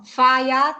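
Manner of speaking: "Fáját" with both á vowels held long; long accented vowels. Shown as a demonstration. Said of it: "il y a l'accent".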